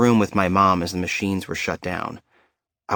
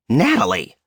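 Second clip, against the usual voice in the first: mom scolding; 4 semitones higher, 4 dB louder than usual. Retold or imitated. Mom scolding daughter's joke.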